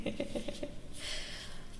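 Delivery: quiet, breathy giggle; little voiceless chuckle; soft laugh. Real, not acted. Reader closing a tarot reading, soft laugh.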